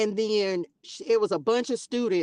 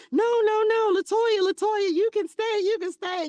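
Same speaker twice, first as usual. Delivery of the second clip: much higher and louder, urgent calling out; excited calling back; 4 dB louder than usual. Retold or imitated. Staff calling the student nurse back into the room, higher and excited. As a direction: call her back excitedly by name. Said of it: "they were like".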